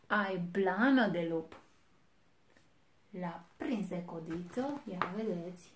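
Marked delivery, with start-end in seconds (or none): 0.1-1.6 slow, loud wolf judgement; big bad wolf. A narrator's reading. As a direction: weigh each word like a predator. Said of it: "a zis".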